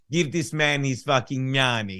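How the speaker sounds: put-on low, soft Russian-accented growl. Quoting a poker movie villain, in a Russian accent. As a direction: quote it quietly in a Russian accent. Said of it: "She doesn't like his Russian accent".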